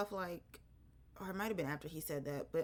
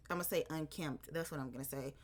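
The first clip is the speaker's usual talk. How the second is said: usual speaking voice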